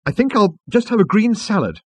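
agitated, high-pitched diner; flustered Arthur. A narrator's reading. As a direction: retreat into a salad order, voice up high. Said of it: "he muttered".

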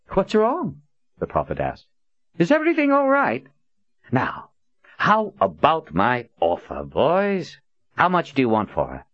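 high innocent mock worry; mock concern. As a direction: fake sympathy, hiding a smirk. Prophet feigning concern.

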